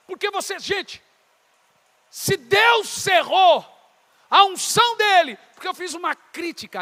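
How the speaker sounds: loud, high, mounting sermon; full-force preaching. Real, not acted. Preacher warning those without a life of prayer, fiery preaching. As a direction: hammer the list, building to a shout.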